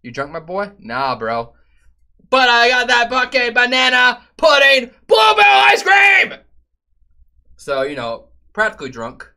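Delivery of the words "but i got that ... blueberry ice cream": high, louder gleeful yell; excited shout. Real, not acted. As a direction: shout it with glee. Excited shout about ice cream.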